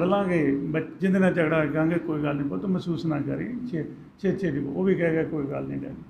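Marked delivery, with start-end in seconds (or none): 1.9-4.6 soft, gentle reassurance to a son; calm the boy down softly; softly voiced father making peace with his son; soothing father. Father soothing son after quarrel. 5.2-6.0 quick, very soft reply; gentle son. Acted. Son answers father gently.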